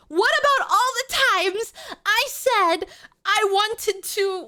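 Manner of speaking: loud, high-pitched mock outrage; exasperated, shouted complaint; indignant rant. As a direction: let them have it, piling up grievances at full volume. Mock quarrel with chat, bone to pick.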